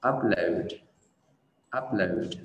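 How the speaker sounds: "upload" as UP-lohd, falling; stress on first syllable. Shown as a demonstration. Teacher modelling for learners, first-syllable stress on "upload". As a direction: hit UP, glide down through "load". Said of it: "Trọng âm rơi vào âm tiết đầu tiên".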